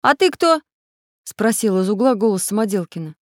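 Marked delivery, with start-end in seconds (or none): 0.0-0.6 high, loud, wary; challenging question. A narrator's reading. Самоделкин answering a voice in darkness, wary. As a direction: ask back sharply, pitched up.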